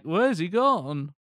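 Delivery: slow, put-on Albanian accent. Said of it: "That's [name]'s Albanian accent".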